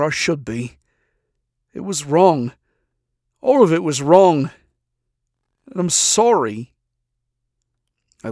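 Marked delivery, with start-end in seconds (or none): 1.7-6.7 louder, higher pleading admission; loud confession. Acted. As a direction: push the apology out, nearly shouting. Confession building to outburst.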